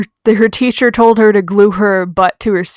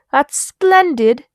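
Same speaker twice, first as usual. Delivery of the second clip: slow, high mock British accent; terrible British accent; 6 semitones higher, 4 dB louder, much slower than usual. Vlogger admits a terrible British accent, slow and high. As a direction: savor it like a posh Brit. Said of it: "my terrible British accent".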